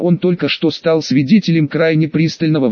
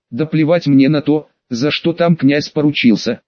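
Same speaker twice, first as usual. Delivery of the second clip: usual speaking voice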